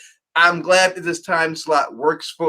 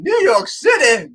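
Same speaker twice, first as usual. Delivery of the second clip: salsa ad shout; 8 semitones higher than usual. Retold or imitated. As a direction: drag it out, outraged. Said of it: "that salsa commercial".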